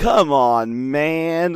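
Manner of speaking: loud imitated groan of complaint; mildly exasperated. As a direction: scold a pal over a spilled drink, hammed-up. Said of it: "their responses are as, as if he spilled a drink".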